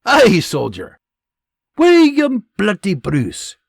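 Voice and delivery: loud, high Scottish threat; Scottish sergeant warning. Acted. Scottish sergeant warning privates about William Bruce. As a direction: drive the warning home, Scottish.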